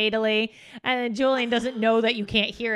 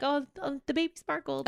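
higher, softer mimicked coo; dainty euphemism; 5 semitones higher, 6 dB quieter than usual. Imitating the friend's grown daughter, soft high coo. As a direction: imitate a delicate little remark about the baby. Retold daughter saying it sweetly.

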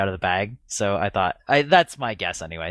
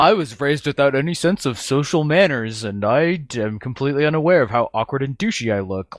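higher nerdy voice, laughing through it; socially awkward nerd voice; 4 semitones higher than usual. Retold or imitated. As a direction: play a clueless awkward nerd. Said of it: "socially awkward nerd voice".

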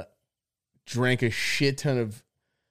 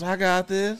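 mock drunk confidence; 6 semitones higher than usual. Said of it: "you tell yourself and everybody else".

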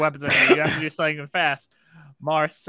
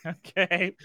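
normal talking voice

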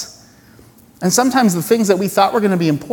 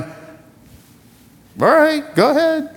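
God shrugging off; 10 semitones higher, slower than usual. Pretend. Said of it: "and he's going".

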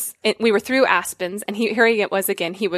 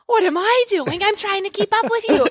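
high, exasperated mock retort; retelling her own snappy comeback; frustrated retort; 11 semitones higher than usual. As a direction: throw his question back at him, fed up. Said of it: "And I was like".

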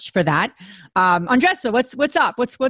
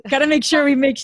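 ordinary talk

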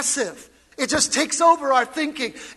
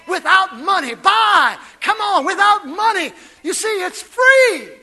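higher, louder, urgent invitation; urgent shout; 4 semitones higher, 5 dB louder than usual. Real, not acted. Pastor urges the church, shouting. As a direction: press the point.

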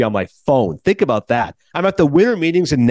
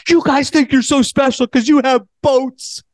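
put-on much higher, slow, whiny childlike plea; pleading like a child; 11 semitones higher, 3 dB louder, slower than usual. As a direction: beg like a sulking kid about the boats. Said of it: "He's pleading like a child".

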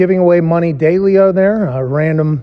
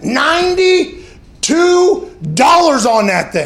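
mock outrage; 8 semitones higher, 3 dB louder, much slower than usual. Outrage at child's taxed allowance.

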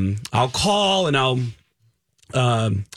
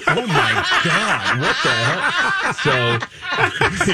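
slow, laughing "what the hell"; laughing disbelief; much slower than usual. Retold or imitated. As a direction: laugh through the shock. Retold shocked reaction, laughing.

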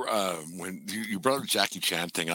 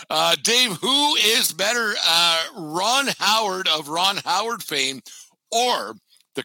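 game show host; 6 semitones higher, slower than usual. Acted. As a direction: pose the question like a show host. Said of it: "host poses a matchup".

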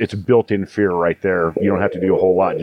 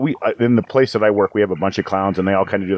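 usual speaking voice

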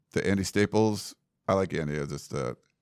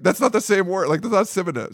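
higher-pitched, incredulous objection; mock protest; 11 semitones higher, 4 dB louder than usual. Newcomer disputing the school's synonyms, mock protest.

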